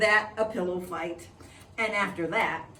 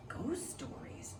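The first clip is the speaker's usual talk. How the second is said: nervous whisper; 6 semitones higher, 9 dB quieter, slower than usual. Acted. Boy alarmed by ghost stories.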